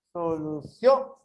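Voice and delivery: "Solução" slow, rising hard onto "ção"; low start, emphatic lifted end on "Solução"; stress on last syllable. Shown as a demonstration. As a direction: punch the final syllable of "Solução". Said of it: "ele tem mais forte aqui".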